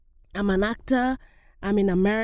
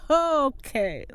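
retold disbelief, laughing it off; incredulous laugh; 6 semitones higher than usual. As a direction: laugh into a higher-pitched okay. Incredulous reply to agent's demand.

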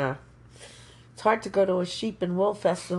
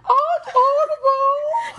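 high, slow, laughing cry of the name; crying out the name with delight; delighted shout; 6 dB louder, slower than usual. Real, not acted. Excited recognition of a relative.

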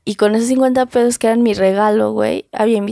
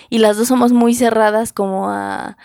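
ordinary talk

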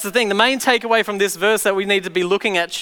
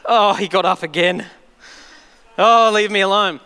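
sighing enemy. Retold or imitated. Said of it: "make the enemy sigh".

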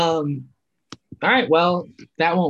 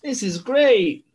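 high, loud, slow awestruck tourist voice; gushing delighted tourist; 9 semitones higher, 3 dB louder, slower than usual. Pretend. Imagining himself in an Italian town, high gushing tourist. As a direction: gush over everything like a wide-eyed tourist.

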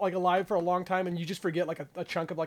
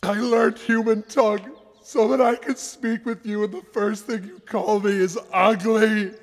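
crying beast; 6 dB louder than usual. Acted. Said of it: "he's crying because I called it ugly".

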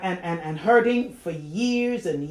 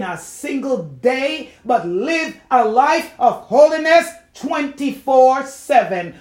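slow build to loud, weighty declarations; emphatic preaching; 5 semitones higher, 8 dB louder, slower than usual. Real, not acted. Urging believers to live holy round the clock, emphatic preaching. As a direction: hammer each phrase to the congregation.

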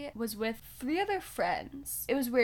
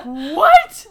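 loud, high mock-shocked outburst; 5 semitones higher, 20 dB louder than usual. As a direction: blurt it out in exaggerated disbelief. Shocked reaction acted in story.

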